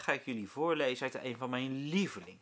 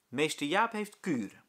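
plain reading voice

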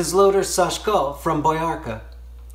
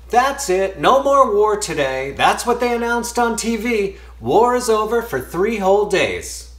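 raised, louder proclamation of big news; excited announcement; 8 semitones higher, 4 dB louder than usual. Acted. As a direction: announce it high and emphatic to the whole tent. Commander announces news in the tent.

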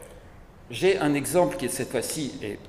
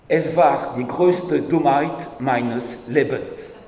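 slow, weighty German quotation, louder; quoting Heidegger in German; 8 dB louder, much slower than usual. Retold or imitated. Lecturer quoting Heidegger's German reply to the students, slow and loud. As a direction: lay out each German word slowly and heavily. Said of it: "Voici ce qu'il a répondu".

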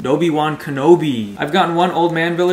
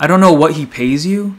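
ordinary talk

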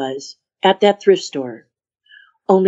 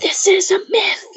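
hushed, breathless whisper; husky low whisper; 13 semitones higher than usual. Retold or imitated. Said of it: "saying in a husky low voice".

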